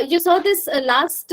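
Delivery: ordinary talk, nothing marked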